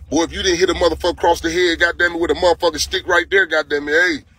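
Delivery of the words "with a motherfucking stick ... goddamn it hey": usual speaking voice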